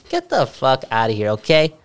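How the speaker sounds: ordinary talk, nothing marked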